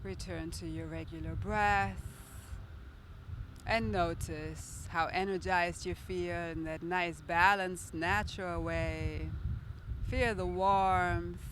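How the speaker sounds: calm, steady guiding voice; guided meditation. Acted. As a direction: guide the breathing gently. Leads a guided breath meditation.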